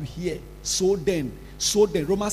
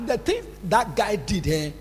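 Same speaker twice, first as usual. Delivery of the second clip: ordinary talk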